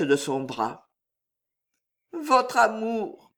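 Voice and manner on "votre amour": slow tender confession. Acted. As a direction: linger over the words lovingly. Juliette's tender answer.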